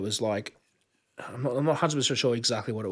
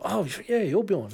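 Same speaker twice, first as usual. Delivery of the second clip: higher, starstruck double-take; starstruck surprise; 8 semitones higher than usual. Retold or imitated. Meeting Bjorn at the party, higher starstruck surprise. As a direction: react as if meeting a legend. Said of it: "I'm like".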